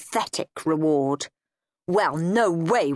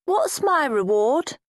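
put-on whiny child; 8 semitones higher, slower than usual. A narrator's reading. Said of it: "He didn't look very happy".

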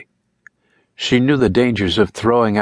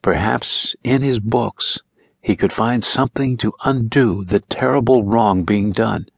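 plain reading voice

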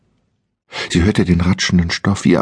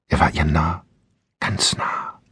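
whisper; 4 dB quieter than usual. A narrator's reading. Captor breathing close by.

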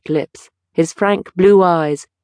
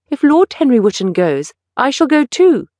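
rising, louder, insistent cry; young man protesting to the painter, rising to a cry; 8 semitones higher, 4 dB louder than usual. Acted. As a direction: threaten to leave with him. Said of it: "cried Dorian Gray".

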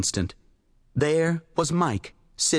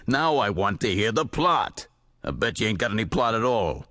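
weary editor; 6 semitones lower than usual. A narrator's reading. Weary editor pressing for plot.

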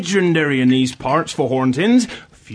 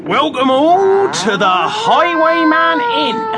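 slow, much higher, grand proclamation; theatrical innkeeper; 12 semitones higher, slower than usual. Acted. Innkeeper grandly welcomes guests to the inn. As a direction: announce it like a spooky host.

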